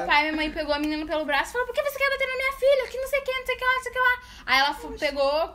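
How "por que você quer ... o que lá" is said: high, insistent mock interrogation; imitated angry parent. Daughter retells parents grabbing the girl, high indignant demand.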